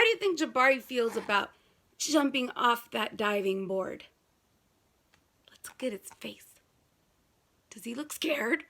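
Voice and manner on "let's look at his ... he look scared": whisper. Real, not acted. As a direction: whisper it like a secret. Reading to child, whispering.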